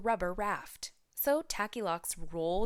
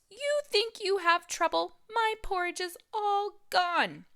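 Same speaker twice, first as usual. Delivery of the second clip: much higher whiny baby bear, mock wail; 14 semitones higher, 5 dB louder than usual. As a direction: complain like a tiny cub. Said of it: "Baby Bear wailed".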